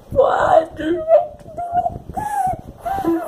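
high sobbing wail of protest; real crying shout. Real, not acted. Sobbing at character's death.

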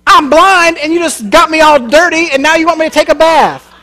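the blind man griping, can you believe this; indignant complaint. Acted. Blind man complaining to Jesus, indignant and exasperated.

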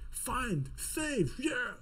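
mock bossy orders. Lecturer playing the shouting boss, mock bossy commands. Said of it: "șeful care urlă și țipă aici".

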